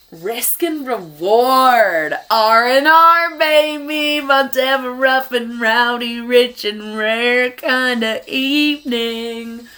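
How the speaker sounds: loud, slow, hyped-up announcer voice. Acted. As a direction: belt it out like a hype man.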